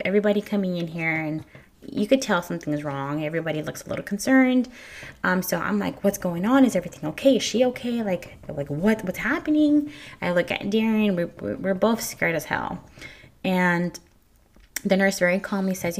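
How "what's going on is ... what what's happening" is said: retold panicked questions. Retold panic as heartbeat lost.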